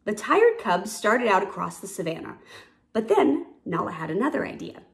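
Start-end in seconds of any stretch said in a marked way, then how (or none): none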